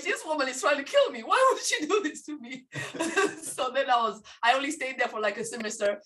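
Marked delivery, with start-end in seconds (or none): 0.0-2.7 higher, half-laughing mock outrage; a laughing mock complaint about mom; mock despair. 2.7-3.5 unvoiced breathy laugh; a short airy laugh. Real, not acted. Laugh after joke.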